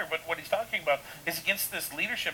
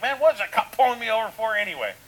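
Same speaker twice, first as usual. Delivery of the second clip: louder, higher mock grumble; indignant complaint; 5 semitones higher, 8 dB louder than usual. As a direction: replay an indignant gripe at being pulled over. Acts out his past indignant complaint.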